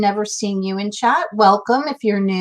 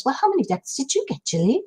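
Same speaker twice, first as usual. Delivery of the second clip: higher, softer, quick mock British accent; sweet little British voice; 4 semitones higher, 5 dB quieter, faster than usual. Host teasingly re-quoting Sandra's question, sweet little British voice. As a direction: imitate a sweet, dainty British speaker. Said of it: "in her sweet little British voice".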